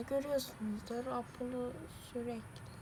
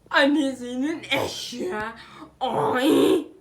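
startled shout; 15 dB louder, slower than usual. Real, not acted. Child startled by pet.

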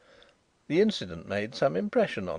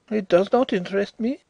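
sulky high dismissal; 10 semitones higher, 6 dB louder than usual. A narrator's reading. Drishna sulking.

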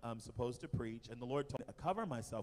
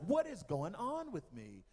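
higher, slowed-down cry of disbelief; retold bewildered alarm; 8 semitones higher, 4 dB louder, slower than usual. Woman retells feeling spiders on her, high bewildered alarm. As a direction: draw out the baffled question, pitch jumping up.

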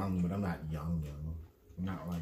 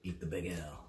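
low, soft, bad French accent; half-hearted attempt at a French accent; 8 semitones lower, 9 dB quieter than usual. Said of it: "That was a really bad accent".

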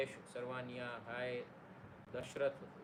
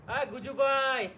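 1 excited call; 8 semitones higher, 8 dB louder, slower than usual. Real, not acted. Greeting friend spotted in chat.

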